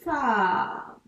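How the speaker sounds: long stretched "Fa"; syllable drawn out. Shown as a demonstration. Teacher writing the second syllable for a pupil, drawn-out "Fa". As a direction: say "Fa" slowly, holding the vowel.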